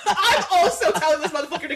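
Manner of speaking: laughing. Real, not acted. Laughing while venting.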